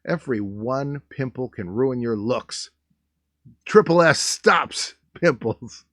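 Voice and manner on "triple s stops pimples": mock announcer. Announcer-style product slogan.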